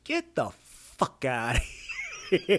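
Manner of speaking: "Get the fuck outta here" stretched very slowly; laughing demo of a drawn-out "fuck outta here"; emphatic. Shown as a demonstration. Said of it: "you hear the emphasis?".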